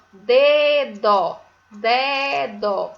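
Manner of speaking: "Dedo" as a long "deee" then an open "DÓ"; syllable by syllable. Shown as a demonstration. Teacher presenting a new word to pupils, "Dedo" in drawn-out syllables. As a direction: sound out "Dedo" in two full beats. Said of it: "Tem duas sílabas da letra D".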